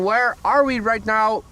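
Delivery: normal talking voice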